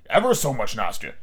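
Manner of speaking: ordinary delivery, nothing marked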